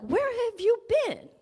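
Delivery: gleeful high-pitched welcome; excited greeting. Retold or imitated. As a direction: call out like a crowd of overjoyed friends. Light beings greeting her, late arrival.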